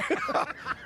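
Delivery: a burst of high, breathy laughter; real laugh. Real, not acted. Trainer laughing at the host's teasing about the room. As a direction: laugh it off, caught out by the joke.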